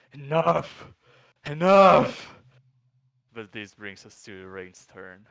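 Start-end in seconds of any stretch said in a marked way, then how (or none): 0.1-0.9 loud, high giant roar; dopey cartoon bellow. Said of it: "the giant says". 1.5-2.4 much louder, higher giant roar; dopey cartoon bellow. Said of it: "the giant says".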